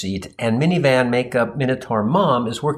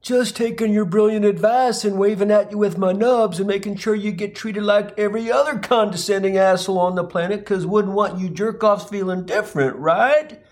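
higher-pitched sneering character voice; snide reply; 6 semitones higher than usual. Acted. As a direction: needle him with mock politeness, voice pitched up. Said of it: "to which he snidely replies".

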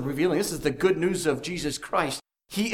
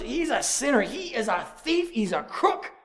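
mock scornful accusation; 7 semitones higher, 3 dB quieter than usual. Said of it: "They would pretty much".

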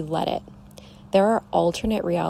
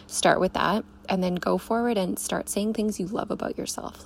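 ordinary delivery

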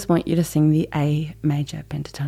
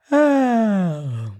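big open yawn sound, sliding down; 3 semitones higher than usual. Shown as a demonstration. Singing teacher showing sob placement, big round yawn. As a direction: yawn it out with the tongue out. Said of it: "A yawn with your tongue out".

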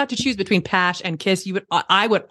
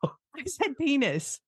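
slow, quiet, sheepish repeat; embarrassed mumble; 5 semitones higher, 9 dB quieter, much slower than usual. Real, not acted. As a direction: drop the voice, say it reluctantly. Repeats rude word when asked.

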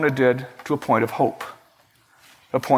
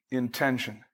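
repeated much softer; a quiet echo for emphasis; 5 semitones lower, 10 dB quieter than usual. Shown as a demonstration. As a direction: let the word drop away quietly.

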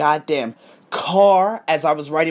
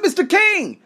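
much higher greeting call; calling out happily; 11 semitones higher than usual. Retold or imitated. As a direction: hail him across the room. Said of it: "I said".

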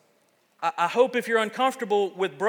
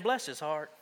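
quiet, soft Southern "Bless his heart"; mock Southern accent, lightly done; 5 semitones lower, 7 dB quieter than usual. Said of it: "Like, in that southern accent".

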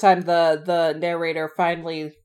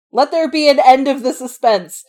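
louder and higher, hammed-up exasperation; dramatic exasperated reading; 6 semitones higher, 8 dB louder than usual. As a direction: declaim it like a fed-up heroine. Reading Fanny's exasperated lines.

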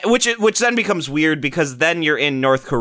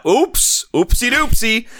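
mock oops; 8 semitones higher, 3 dB louder, slower than usual. Mocking the game's blunder.

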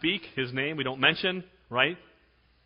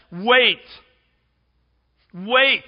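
forceful preaching; 8 semitones higher, 9 dB louder than usual. Acted.

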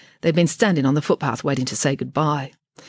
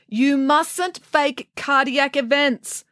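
slow, high scolding doctor; doctor scolding the patient; 10 semitones higher, much slower than usual. Acted. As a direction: tell off the old lady slowly. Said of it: "scolded the young doctor".

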